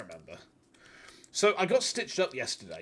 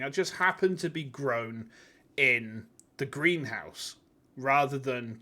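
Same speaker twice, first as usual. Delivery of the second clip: ordinary talk, nothing marked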